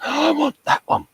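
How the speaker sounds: put-on high, breathless childish want after a gasp; kid in a candy shop. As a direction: play the greedy little kid spotting the big box. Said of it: "He's the kid in the candy shop".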